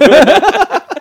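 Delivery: high, loud laugh; big high-pitched laugh; loud burst of laughter. Real, not acted.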